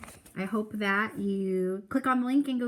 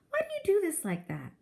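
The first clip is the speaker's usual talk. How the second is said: higher, fussy mother voice; mock Mama; 12 semitones higher than usual. Narrator mimicking his mother's complaints, higher fussy voice. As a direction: imitate Mama fussing.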